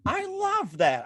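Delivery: high-pitched burst of delight. Real, not acted. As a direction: gush over advice you love. Host delighted by the advice.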